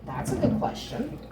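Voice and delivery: breathy whispered aside. Real, not acted. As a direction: whisper it to yourself. Aside to herself.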